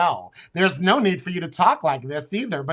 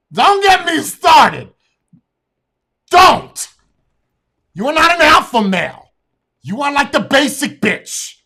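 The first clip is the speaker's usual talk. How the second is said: furious shout; 9 semitones higher, 10 dB louder than usual. Real, not acted. Streamer screaming insults at guy in clip. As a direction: scream it, drawing out the words.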